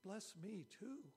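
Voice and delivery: a son's raw, slow cry for his father's blessing; bitter pleading cry. A narrator's reading. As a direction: draw out the plea like a cheated son begging. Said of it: "he let out a loud and bitter cry".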